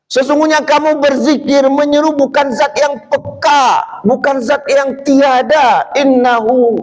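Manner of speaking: high, insistent sermon voice; high preaching. Acted. Preacher explaining the Prophet's rebuke, high and insistent. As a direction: press the point home.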